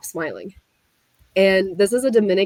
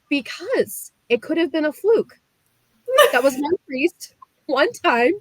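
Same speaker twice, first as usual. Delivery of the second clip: insistent pleading; 8 semitones higher than usual. Retold or imitated. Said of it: "And I said".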